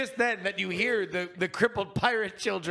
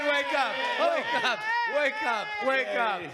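higher, repeated wake-up call; 7 semitones higher than usual. Acted. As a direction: rouse someone from sleep. Waking the sleeper.

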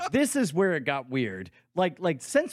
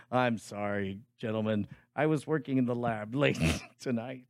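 low, softening mock apology; imagined Bryce apology; 6 semitones lower, 4 dB quieter than usual. Picturing a friend excusing himself to the gentlemen, mock-apologetic. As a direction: apologise in a subdued, lower voice. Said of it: "I love to picture Bryce saying".

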